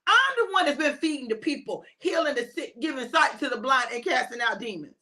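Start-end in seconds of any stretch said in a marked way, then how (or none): none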